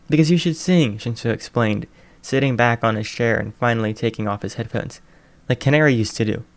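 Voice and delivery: ordinary delivery, nothing marked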